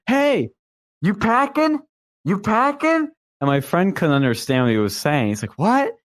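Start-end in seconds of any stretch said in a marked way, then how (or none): 0.1-3.1 high-pitched mock challenge; imitated confrontational stranger. Stranger challenging the friend, high-pitched imitated confrontation. 5.6-5.9 high-pitched baffled question; imitated confusion. Friend couldn't understand the stranger, high mock-baffled query.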